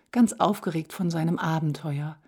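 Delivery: ordinary delivery, nothing marked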